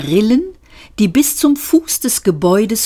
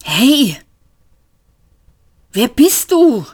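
gasped out, higher and louder; breathless alarmed cry; panting; 7 semitones higher, 3 dB louder, slower than usual. Acted. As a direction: call out while gasping for air. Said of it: "keuchte Seifert".

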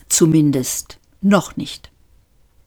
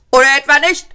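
high, louder shouted question; shouting policeman; 10 semitones higher, 5 dB louder, much faster than usual. A narrator's reading. Policeman calling to his colleague, shouted. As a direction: call it over to the colleague. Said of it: "fragte er seinen Kollegen".

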